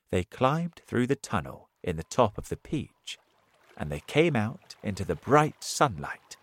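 plain reading voice

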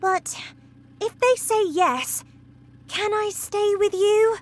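put-on girl asking her teacher; hopeful girl. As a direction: ask with hope rising.

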